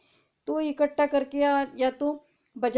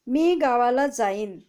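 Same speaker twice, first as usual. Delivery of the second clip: louder and slow, word by word; slow reading aloud; 6 dB louder than usual. Shown as a demonstration. Teacher reading an example sentence to students, slow and loud. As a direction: read it out slowly for the class. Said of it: "आपको पढ़ के भी दिखाती हूँ".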